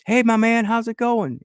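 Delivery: loud, high-pitched mock chummy hello; best-friend greeting. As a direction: greet him like a long-lost best pal. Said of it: "talking to me like I'm his best friend".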